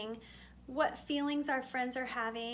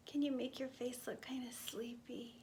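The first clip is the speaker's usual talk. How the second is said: soft drowsy whisper; hushed whisper to sleepy children; 8 dB quieter than usual. Shown as a demonstration. Sleepy poem line for children.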